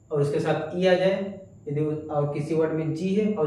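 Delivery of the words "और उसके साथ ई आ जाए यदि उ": ordinary delivery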